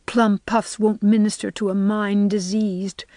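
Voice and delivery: usual speaking voice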